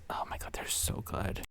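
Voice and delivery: near-whisper, trailing away softly; hushed raving. Real, not acted. Podcaster raving about favorite boneless wings, hushed near-whisper. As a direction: drop to a whisper of food bliss.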